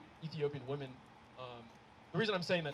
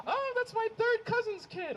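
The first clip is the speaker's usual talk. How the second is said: high, slow, louder mom voice; mock mom; 4 dB louder, slower than usual. Mom telling son the girl is family, high mock breezy.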